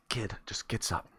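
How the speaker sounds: ordinary delivery, nothing marked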